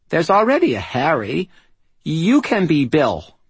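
put-on high, babyish five-year-old; little girl voice. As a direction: lisp it out like a proud little princess. Amanda speaks again.

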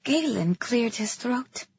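plain reading voice

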